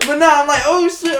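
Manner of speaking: high loud laugh into words. Real, not acted. Telling the taser story to friends, laughing high. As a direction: crack up and keep talking.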